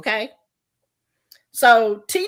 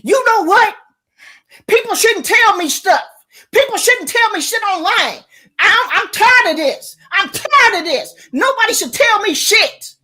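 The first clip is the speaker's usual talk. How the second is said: higher, louder indignant voice; changed voice; 7 semitones higher, 4 dB louder than usual. Retold or imitated. Quoting Michelle when her voice changed, indignant. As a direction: put on her changed voice, fed up. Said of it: "Her voice changed".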